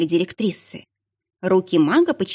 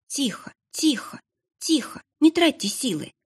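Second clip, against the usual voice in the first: anxious hush; 8 semitones higher than usual. Acted. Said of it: "зачастила Вертрана". (тревожно, торопливо, тихо)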